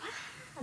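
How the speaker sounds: high, soft mock scream; small high-pitched "ah" of retold terror; imitated yell. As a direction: imitate a scream under your breath. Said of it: "I remember yelling".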